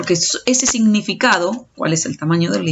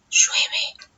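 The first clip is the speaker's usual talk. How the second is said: very soft, voiceless whisper; 5 dB quieter than usual. Shown as a demonstration. Teacher showing students volume as paralinguistic, whispering. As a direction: whisper it as the lowest volume setting. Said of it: "el volumen con que lo dije".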